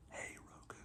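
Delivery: barely voiced 'Hey, Roku' so the remote won't wake; a quick hushed whisper so the device won't trigger. Shown as a demonstration. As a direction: breathe the wake word under your breath. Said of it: "If I say it, it'll go off".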